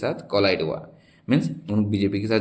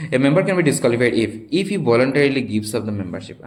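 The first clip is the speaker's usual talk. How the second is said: reading a question; 3 semitones higher, 4 dB louder, much slower than usual. Shown as a demonstration. Teacher reads quiz question aloud.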